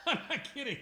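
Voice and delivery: laughing through tears, voice jumping up; embarrassed laugh while tearing up; teary laugh. Real, not acted. Artist admits to viewer he is crying, laughing through it. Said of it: "you got me tearing up a little bit".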